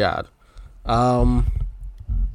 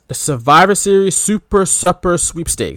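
higher, deliberately spaced-out contest title; grand announcement; 5 semitones higher than usual. Shown as a demonstration. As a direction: present it like a big reveal. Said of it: "you ready for this?".

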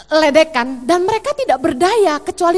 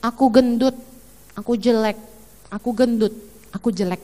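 put-on softer, lower sad little girl; fading into sad self-pity; quiet child's lament; 7 semitones lower, 4 dB quieter than usual. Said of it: "dia bilang gini".

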